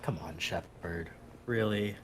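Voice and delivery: low, quiet, slow disapproval; softly chide, as if giving a side-eye; imagined side-eye. Retold or imitated. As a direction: imitate a weary, unimpressed companion. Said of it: "I did like the side eye she was giving Shepard".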